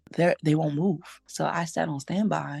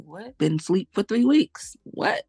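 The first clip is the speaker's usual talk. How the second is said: shocked; 5 semitones higher, 6 dB louder than usual. Retold or imitated. Waking from coma, learns date.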